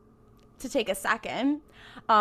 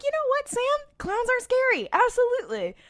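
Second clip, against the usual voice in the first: much higher, squeaky pleading tone; high mock-scared voice; 13 semitones higher than usual. Voicing self talking to Sam.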